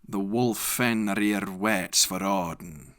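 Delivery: plain reading voice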